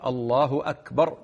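"أكبر" begun on a clean glottal stop, short last vowel; slow "الله أكبر" with a break before akbar; cut hamza. Shown as a demonstration. Sheikh showing the lawful takbir, cut hamza. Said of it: "يقول أكبر على القطع".

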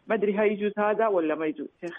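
normal talking voice

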